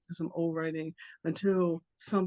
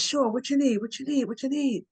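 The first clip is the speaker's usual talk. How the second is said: much higher, eager squirrel chatter; 7 semitones higher, 4 dB louder than usual. Acted. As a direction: chirp it up high and keen, a touch louder. Squirrel eagerly agrees to errand.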